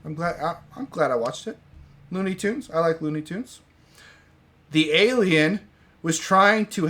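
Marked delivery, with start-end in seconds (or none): none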